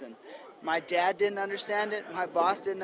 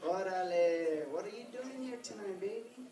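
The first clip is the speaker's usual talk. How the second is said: put-on Mexican accent; 2 semitones higher, 5 dB quieter than usual. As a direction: play a tough Mexican-accented flirt. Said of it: "so this is how we sound".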